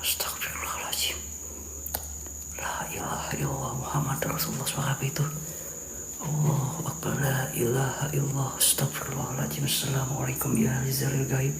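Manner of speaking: soft, hushed prayer; frightened whispered prayer. Real, not acted.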